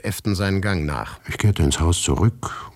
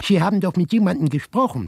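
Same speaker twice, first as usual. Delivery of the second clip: high-pitched neighbor; 13 semitones higher than usual. A narrator's reading. Neighbor Maria asks the narrator in the corridor, high-pitched.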